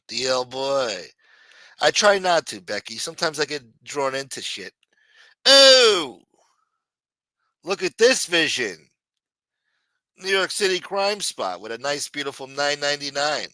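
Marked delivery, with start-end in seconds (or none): 5.4-8.8 sudden high excited yelp; excited shout. Real, not acted. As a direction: burst out with delight. Excited reaction to donation.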